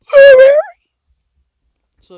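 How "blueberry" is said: high, loud squeal of delight; excited shriek. Real, not acted. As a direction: shriek the flavour name. Excited reaction to the flavour.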